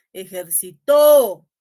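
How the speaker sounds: "Ejercitó" slow, with a very loud, long TÓ; stress on final TÓ. Shown as a demonstration. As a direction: hammer the last syllable: e-jer-ci-TÓ. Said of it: "pronuncien las palabras con distintas". ("Ejercitó" despacio, con TÓ final muy fuerte y largo)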